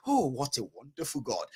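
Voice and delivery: low, soft, contented praise; talking nicely. Retold or imitated. Contented traveler praising God, low and soft. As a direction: drop to a soft, low marvel. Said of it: "You talk to God so nicely".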